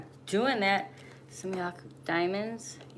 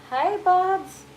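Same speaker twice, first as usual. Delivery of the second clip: high greeting; 12 semitones higher, 7 dB louder than usual. Real, not acted. Playful greeting to a viewer.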